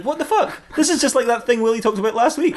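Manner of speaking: high-pitched comic disbelief; incredulous. Acted. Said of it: "And he's like".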